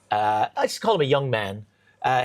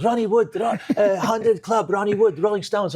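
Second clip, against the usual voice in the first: higher, eager broken English, lightly voiced; broken English fan; 6 semitones higher than usual. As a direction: plead it like a grateful young fan. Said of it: "he was in broken English".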